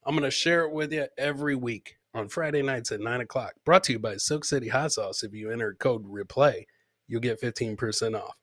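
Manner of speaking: ordinary talk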